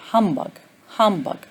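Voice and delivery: "humbug" as HUM-bug; stress on first syllable. Shown as a demonstration. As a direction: hit HUM in "humbug", let bug fall. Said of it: "the first, uh, syllable is stressed".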